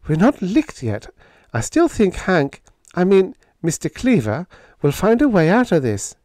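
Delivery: high, plucky female character voice; game young heroine. Acted. Helen insisting Hank will find a way out, plucky. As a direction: speak up high and undaunted. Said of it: "was as game as her old man".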